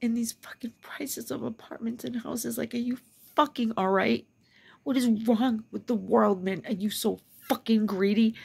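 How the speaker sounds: real exasperated outrage; outraged rant. Real, not acted. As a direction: demand answers from a greedy world, punching the swear words. Ranting at greedy prices.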